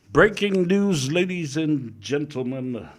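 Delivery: high, slow, booming "Breaking news" announcement; announcer voice. Acted. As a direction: draw it out like a TV announcer.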